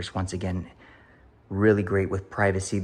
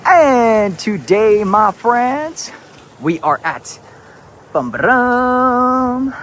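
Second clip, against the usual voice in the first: high, loud, drawn-out announcer greeting; hyped vlog intro; 13 semitones higher, 12 dB louder, slower than usual. Acted. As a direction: open the show big and slow, like a host.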